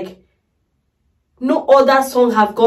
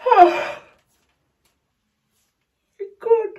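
high, crying oh my God; real overwhelmed sob; choked cry; 10 semitones higher than usual. Real, not acted. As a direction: let the voice break into tears. Overcome, crying at song.

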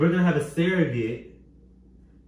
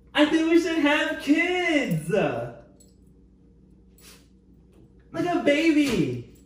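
mock sweet proposal; 8 semitones higher, slower than usual. The baby prank proposal.

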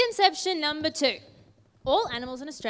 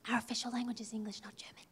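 quick, unvoiced whisper into the microphone; stage whisper; 10 dB quieter, much faster than usual. Presenter setting a co-host straight about the language, quick stage whisper. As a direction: whisper a hurried correction. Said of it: "Why are we whispering into the microphones?".

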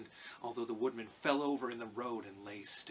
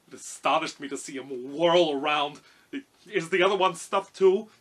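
loud, lightly voiced lion; astonished lion; 5 semitones higher, 14 dB louder than usual. A narrator's reading. Lion asks Dorothy about her companions, loud astonished character voice. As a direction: marvel loudly, lifting into the question.

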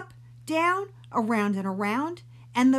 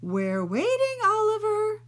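high, louder sing-song prompt; impatient teacher; 14 semitones higher, 5 dB louder than usual. Acted. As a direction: call him out before the class. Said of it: "said Missus Oppenheimer".

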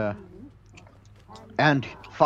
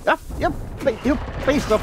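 angry yelling; 11 semitones higher, faster than usual. Real, not acted. Cursing at boss in fight.

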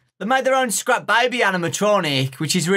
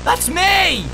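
excited; 13 semitones higher than usual. Real, not acted. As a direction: shout it with glee. Excited recognition.

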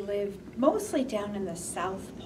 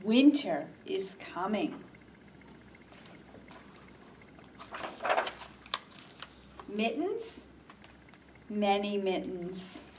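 put-on reading to children; slower than usual. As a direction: read gently to little ones. Reads a picture book aloud.

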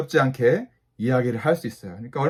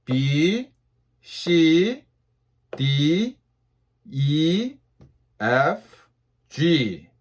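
letters read slowly, one by one; slow alphabet drill for repeating; much slower than usual. Shown as a demonstration. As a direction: draw out each letter and pause after it. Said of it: "천천히".

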